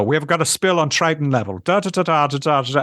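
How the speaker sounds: a lightly voiced fussy troll manager, German-tinged; middle management troll. A troll manager reports a spill, lightly imitated. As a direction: mimic a busy troll boss calling a spill. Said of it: "plays this middle management troll".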